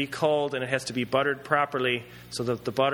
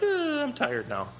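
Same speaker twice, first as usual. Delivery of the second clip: tired; 11 semitones higher than usual. Retold or imitated. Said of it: "You're like".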